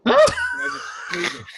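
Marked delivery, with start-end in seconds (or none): 0.1-0.1 short, high mock yelp; little-bitch scream. Man shows how he screams at bugs, mock shriek. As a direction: squeal in mock fright at a bug. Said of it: "I scream like a little bitch and just go".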